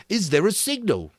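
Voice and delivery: high, anxious question. A narrator's reading. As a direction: ask with worry. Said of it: "said Piggy anxiously".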